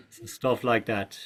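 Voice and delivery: ordinary talk, nothing marked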